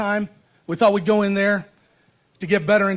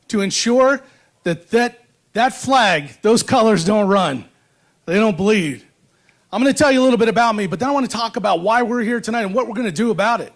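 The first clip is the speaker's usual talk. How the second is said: podium speech. Acted. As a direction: stir up the crowd from the podium. Rousing speech to rally crowd.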